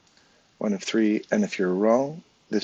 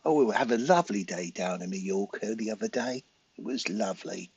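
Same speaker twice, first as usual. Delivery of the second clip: mock British tourist accent, gushing about a lovely day. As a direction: play a chirpy Brit on holiday in a British accent. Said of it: "When the Brits come in and with their accent".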